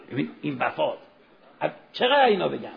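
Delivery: normal talking voice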